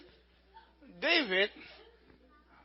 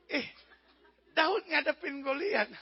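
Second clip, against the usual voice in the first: ordinary delivery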